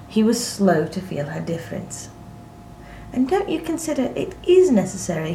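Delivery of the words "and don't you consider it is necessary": high, quick, doubtful question; hurried, uneasy questioning; misgiving. A narrator's reading. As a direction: ask with misgiving, pitched up. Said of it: "he added with misgiving".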